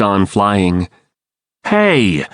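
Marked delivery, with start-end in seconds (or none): 1.6-2.3 mild yell. A narrator's reading. Marco protests as a spear is fired, mildly yelling. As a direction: call out in restrained protest. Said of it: "Marco yelled".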